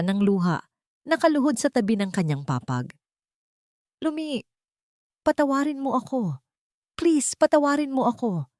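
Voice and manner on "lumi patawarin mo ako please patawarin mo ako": high, tearful begging; sobbing plea. Acted. Rafael kneeling by Lumi's bed, sobbing for forgiveness. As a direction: plead for forgiveness through tears. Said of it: "hikbi ni Rafael".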